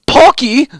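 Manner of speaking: very high, loud delighted squeak; excited squeal. A narrator's reading. Medic hearing Heavy offer Pocky, loud high squeal. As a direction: squeal the word with glee. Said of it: "eyes going wide".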